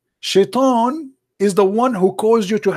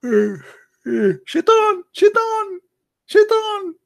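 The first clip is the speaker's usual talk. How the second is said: rising eerie wail, much higher; spooky wail; much slower than usual. Pretend. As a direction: wail the name like a ghost. Mock wailing the name.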